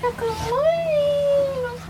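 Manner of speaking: high, slow sing-song greeting; pet voice. Real, not acted. Greeting pet again, drawn out.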